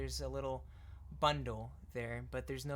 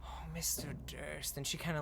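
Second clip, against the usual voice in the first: ordinary talk, nothing marked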